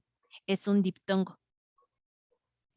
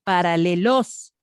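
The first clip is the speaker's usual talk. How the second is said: Paralelos drawn out, loud rising push on LOS; exaggerated last syllable; 2 semitones lower, 6 dB louder, slower than usual. Shown as a demonstration. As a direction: test Paralelos as paraleLOS, slow and loud. A ver, pronuncia paralelos.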